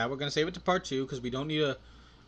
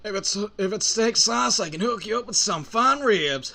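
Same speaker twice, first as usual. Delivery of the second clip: higher, drawling cowboy voice; cowboy lawman; 7 semitones higher, 6 dB louder than usual. Acted. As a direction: offer it like a folksy cowboy.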